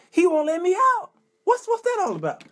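high-pitched, put-upon complaint; exasperated plea. Acted. Trapped churchgoer calling the fire department, exasperated. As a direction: plead in disbelief, voice climbing high. Acted panicked call for rescue.

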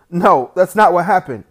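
put-on a boy loudly denying his brother's story to the aunt; loud protest. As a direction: shout the denial at the aunt.

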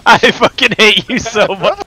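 loud. Acted. Loud joking insult.

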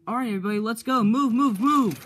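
loud, high rallying yell; shouting orders. Acted. James yelling at his team to move out, loud rally cry. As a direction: bark the team into motion.

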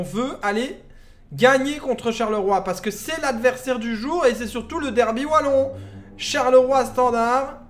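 big derby build-up, drawn out and punched; match announcer hype. Acted. Streamer announcing the Walloon derby of the day, loud announcer hype. Mock commentator hyping the match kick-off.

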